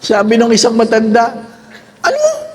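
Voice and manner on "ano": put-on high, comic old-person "Ano?"; hammed-up deaf granny; deaf old person asking. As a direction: squeak it like you can't hear. Old person who can't hear.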